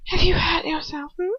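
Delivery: mock concern. A narrator's reading. Bellatrix mocks victim.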